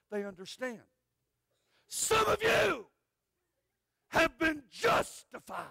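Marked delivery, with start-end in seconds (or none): 1.9-5.7 high, loud, drawn-out preacher call; booming preacher. Retold or imitated. Evangelist preaching to the crowd in a booming voice. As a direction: boom it out like a revival preacher. Preacher quoted in big sermon voice.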